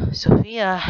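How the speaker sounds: a hint of a break in the voice; raw, strained call of a sister's name; wavering voice. A narrator's reading. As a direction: voice nearly cracking on the name. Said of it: "hitting the waiver in his voice".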